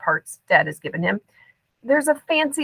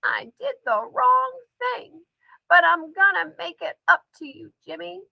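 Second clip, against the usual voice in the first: eerie grandmother voice, higher; G-Ma's voice; 9 semitones higher, 4 dB quieter than usual. A narrator's reading. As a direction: speak as the grandmother through the father. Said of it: "Dad says in G-Ma's voice again".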